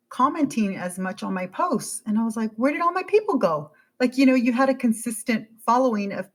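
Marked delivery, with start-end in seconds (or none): 2.6-3.7 high, puzzled cry; mock dismay. Creator to missing followers, high puzzled dismay. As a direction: ask it like the crowd vanished. Wondering where followers went.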